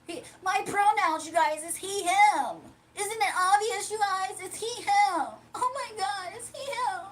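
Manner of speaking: high, whiny, exasperated man insisting on his pronouns; rising shrill whine that climbs to a near cry; mock Dimitri pleading with chat over his pronouns; Dimitri impression. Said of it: "That's Dimitri, y'all".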